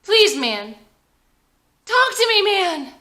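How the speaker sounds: high, loud, desperate plea; pleading, close to tears. Acted. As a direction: beg him to answer, voice straining. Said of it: "Blake is crying now".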